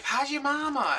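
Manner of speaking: high, loud neighbourly holler; calling out. Retold or imitated. Neighbours greet her in the story, high holler.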